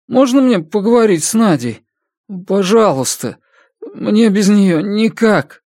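high-pitched, agitated pleading; desperate pleading. A narrator's reading. Иван begs to speak to Надя, desperate pleading.

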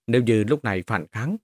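plain reading voice

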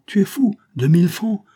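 high, loud incredulous retort; outraged disbelief. Acted. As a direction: balk loudly at the sum. Count balks at the sum demanded.

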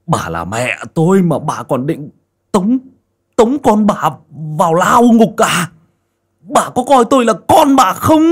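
rising to a high, loud outburst; angry son. A narrator's reading. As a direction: build from hurt to an angry cry. Son rages at mother.

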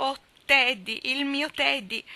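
high, loud, slow cry of delight; joyful exclaim. A narrator's reading. Joe greeting Teddy back home, overjoyed. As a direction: greet him with overjoyed surprise. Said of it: "esclamando tutta allegra".

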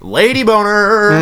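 high, slow, drawn-out mock voice; mock lady voice. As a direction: stretch it out in a silly high voice. Joking exaggerated phrase.

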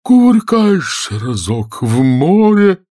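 high, loud, drawn-out cry; carried-away turtle exclamation; exclaiming. A narrator's reading. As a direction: call it out with slow relish. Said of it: "воскликнул черепаха Квази".

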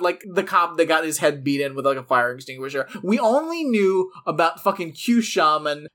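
usual speaking voice

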